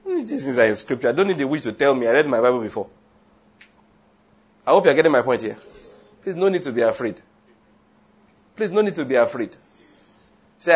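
ordinary talk

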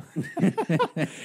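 breathy high unvoiced laugh; whispered giggle. Real, not acted. Teller laughing at his own awkward joke, whispered giggle.

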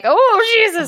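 high, loud startled cry; shocked gasp. Retold or imitated. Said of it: "He's just like".

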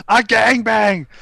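high mock holler; mock yell. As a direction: mimic the movie guy calling out. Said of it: "he just yells".

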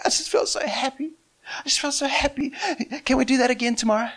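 put-on high, small child's voice; imitating happy, eager children; excited kids. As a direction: plead eagerly like a little kid.

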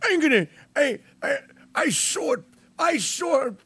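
high, stammering mock Greta; Greta rant. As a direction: splutter in mock outrage. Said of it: "Greta impression again".

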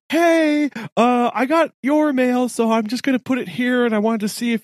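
bright friendly note-to-neighbour voice. Retold or imitated. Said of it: "so I was like".

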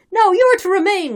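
high, loud insistent call; shouted from crowd. A narrator's reading. Crowd telling the officers to stay, shouted. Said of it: "was shouted from the crowd".

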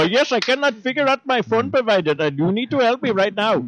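high-pitched Indian accent imitation; mock Indian accent. Call-center worker on the phone, mock Indian accent.

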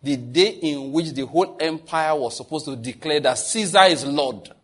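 normal talking voice

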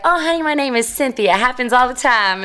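high, sing-out imitation of a friendly receptionist; receptionist's voice. Woman behind the desk reassuring a visitor, high-voiced. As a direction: reassure a flustered visitor, pitched well up.

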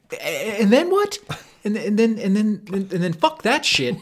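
loud, high, stammering mock outrage; hammed-up sputtering tirade; imitating friend's rant. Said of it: "and you'll be like".

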